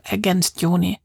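ordinary delivery, nothing marked

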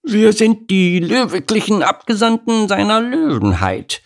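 high self-important announcement; mock royal envoy; puffed-up pomp. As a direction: chest out, proclaim it. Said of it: "warf sich Henriette in die Brust".

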